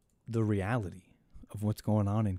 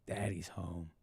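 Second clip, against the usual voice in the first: much lower, much softer, deep and slow; deep hushed mock-menace; low hushed growl; 6 semitones lower, 11 dB quieter than usual. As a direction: purr it low like a smug boss.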